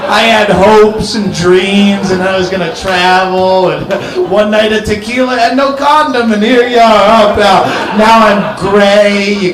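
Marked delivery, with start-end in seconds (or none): none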